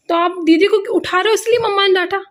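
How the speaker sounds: scolding. Real, not acted. Mother scolds son for waking sister.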